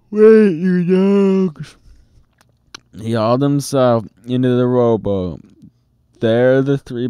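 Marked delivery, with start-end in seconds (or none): 0.1-1.8 high, slow, loud call; desperate shout. A narrator's reading. Captain in the surf yelling at his crew, desperate shout. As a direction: stretch it out across the water. Captain wading after boat.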